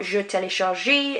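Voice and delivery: "télécharge" said wrongly with an EE on the end; "télécharge" as tay-lay-shar-ZHEE; wrongly sounded final E. Shown as a demonstration. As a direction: add a mistaken "zhee" ending to "télécharge". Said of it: "not pronouncing that E at the end".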